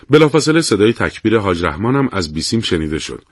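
ordinary delivery, nothing marked

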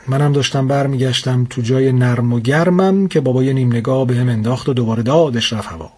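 usual speaking voice

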